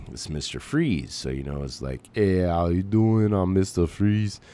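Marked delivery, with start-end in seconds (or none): 2.1-4.4 bad Schwarzenegger accent. Shown as a demonstration. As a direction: do a terrible Schwarzenegger-style Austrian accent. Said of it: "Terrible Schwarzenegger".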